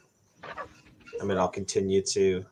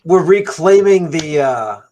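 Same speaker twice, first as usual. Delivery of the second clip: loud, much higher proclamation; loud declaration; 3 semitones higher, 12 dB louder than usual. Acted. Player character confronting the dragon, loud and proud.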